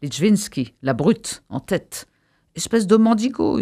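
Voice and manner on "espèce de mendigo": moderately raised, higher-pitched jeer; yelled insult. A narrator's reading. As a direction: hurl the insult, only half yelling. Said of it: "hurlèrent-ils". (insulte criée à mi-force)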